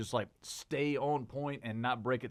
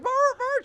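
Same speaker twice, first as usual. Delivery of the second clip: loud, very high double yelp; piercing imitated yelps; shocked dog yelps; 8 dB louder than usual. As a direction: mimic a dog squealing in shock.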